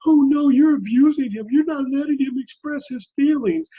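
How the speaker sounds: high, loud mock-horrified protest; mock outrage. Host mimicking critics of a stern dad, mock outrage. Mocks people objecting to stern dads.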